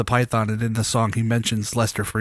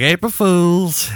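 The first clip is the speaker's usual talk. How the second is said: drawn-out, higher and louder announcement; gleeful prank reveal; 6 semitones higher, 5 dB louder, much slower than usual. Retold or imitated. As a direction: stretch it out like a triumphant prankster.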